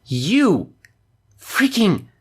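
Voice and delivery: high stunned outburst; shocked disbelief. Real, not acted. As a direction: squeak out the disbelief. Shocked at being tranquilized.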